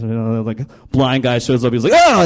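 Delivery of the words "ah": sudden high, loud yelp; Jesus's miracle sound effect. Retold or imitated. Jesus healing a blind guy, sudden high yell. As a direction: burst out with a mock miracle cry. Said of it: "Jesus did that as well".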